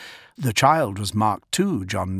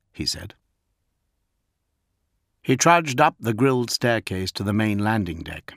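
usual speaking voice

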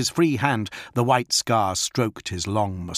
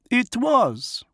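higher, slow, proud wolf chieftain; Fafnir the wolf lord. Acted. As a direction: speak as the feral wolf lord, measured. Fafnir answers.

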